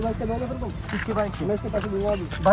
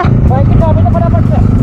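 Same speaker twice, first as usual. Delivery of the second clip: raised, much louder voice, drawn out; loud demand; 5 semitones higher, 9 dB louder, slower than usual. Real, not acted. Man demanding bike keys in a quarrel, loud demand. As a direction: demand it loudly and high.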